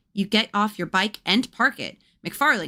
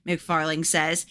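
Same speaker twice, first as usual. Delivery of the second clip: ordinary delivery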